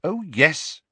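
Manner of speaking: firm, steely agreement. A narrator's reading. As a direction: say it with a touch of steel. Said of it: "she said in a steely voice".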